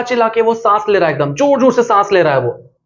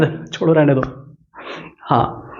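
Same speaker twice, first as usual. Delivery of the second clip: whisper; 6 semitones lower, 6 dB quieter than usual. Real, not acted. Quiet aside after joke.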